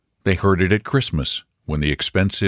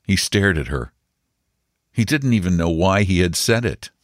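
plain reading voice

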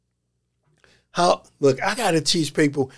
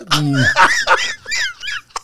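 big laugh. Real, not acted. Laughing after punchline.

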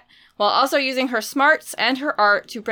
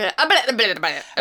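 mock babble. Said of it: "it would just be us going".

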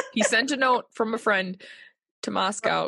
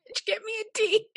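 high-pitched repeat; 11 semitones higher than usual. Acted. Repeating the joke.

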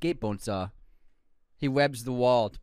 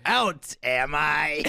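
slow menace; much slower than usual. Acted. Acting Norman reply.

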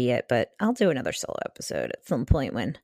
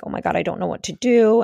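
panicked; 5 semitones higher, 5 dB louder than usual. Retold or imitated. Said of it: "my mom was calming me down".

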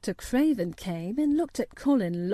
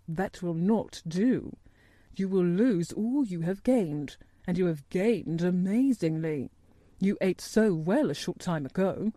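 measured, slightly lowered doctor's voice; grave doctor; 2 semitones lower than usual. Acted. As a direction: state concern formally and soberly. Doctor Craven speaks gravely.

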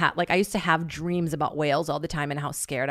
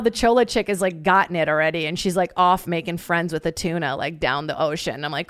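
ordinary delivery

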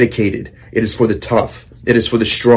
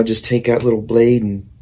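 normal talking voice

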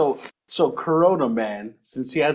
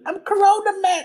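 put-on much higher playground kid; high kid announcing himself; high child voice; 4 dB louder than usual. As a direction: pipe up like a kid playing superhero. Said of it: "Little kids playing outside".

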